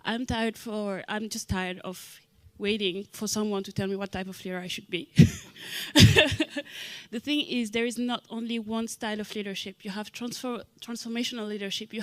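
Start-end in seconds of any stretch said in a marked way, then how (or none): 5.1-7.1 loud burst of laughter. Real, not acted. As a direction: laugh out loud, falling away. Laughs at her own remark.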